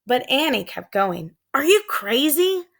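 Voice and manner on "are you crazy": higher called alarm; calling out. A narrator's reading. Jack calling after Annie climbing down to the creature. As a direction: yell after her in disbelief. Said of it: "Jack called".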